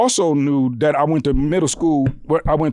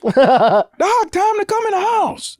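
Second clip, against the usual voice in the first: mom calling out; slower than usual. Retold or imitated. Mom calls her son into the house, high-pitched holler. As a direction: imitate a mother calling her child indoors.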